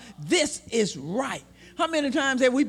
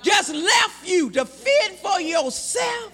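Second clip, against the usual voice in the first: slow impassioned hollering sermon; preaching at full force; 4 semitones higher, slower than usual. Real, not acted. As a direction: drag it out and shout it. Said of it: "preaching climax".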